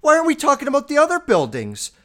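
high, loud, whiny protest; hammed-up shrill indignation; mocking someone's outburst. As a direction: shout it high like a clueless objector. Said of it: "is like someone going".

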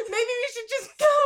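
high, loud, hammed-up freak-out; mock panicked yell. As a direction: play at screaming to get away. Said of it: "freaking out and screaming".

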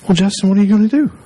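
high, rushed pleading little brother; tearful whine. Retold or imitated. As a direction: imitate a worried kid brother whining. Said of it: "kind of whine in his voice".

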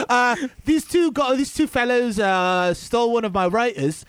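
hammed-up British host accepting an award; John Oliver. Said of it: "John Oliver saying it".

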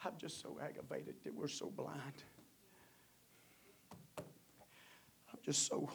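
soft, broken whispered sorrow; hushed lament. Real, not acted. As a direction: whisper it like a grieving confession.

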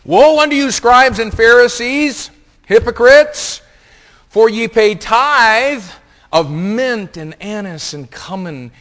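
loud, high, drawn-out proclamation; thundering scripture reading. Acted. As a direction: preach the verse at full force, slowly. Preacher reads scripture at full force.

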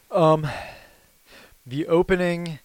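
breathing hard between words; winded after hot wings; heavy breathing. Real, not acted. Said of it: "Sorry, I'm breathing heavy into the".

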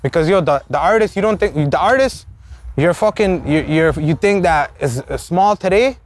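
normal talking voice

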